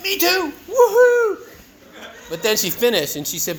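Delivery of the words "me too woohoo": much higher, loud whoop; cheering on hearing Debbie dislikes people too; mock cheer. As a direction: whoop with glee. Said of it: "And I went".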